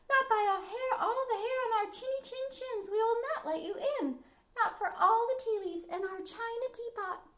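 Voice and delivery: defiant little voices, laughing a bit; little wolves. A narrator's reading. Wolves taunting the pig from inside, high and squeaky. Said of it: "said the little wolves".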